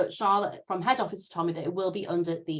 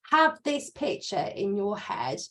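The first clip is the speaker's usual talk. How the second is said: ordinary talk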